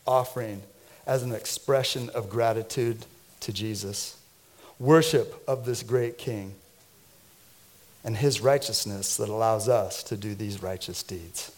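ordinary talk, nothing marked

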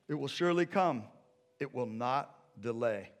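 loud, high proclaiming; preached scripture. Acted. Preacher proclaiming the vision to the church. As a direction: declare the promise.

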